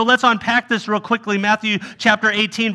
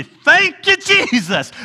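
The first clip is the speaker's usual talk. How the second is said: overjoyed thanks; 10 semitones higher, slower than usual. Retold or imitated. Freed from the mortgage, he bursts out in joyful thanks. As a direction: burst out in happy relief.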